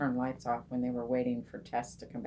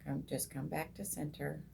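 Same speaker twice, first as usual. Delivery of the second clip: softer, calm nudge to herself; gentle self-reminder; 9 dB quieter than usual. Retold or imitated. Telling her wandering mind, softly come back. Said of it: "and then it's like".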